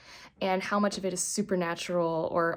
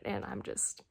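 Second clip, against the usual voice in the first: whispering; 7 dB quieter, slower than usual. Real, not acted. Can't talk about spoilers.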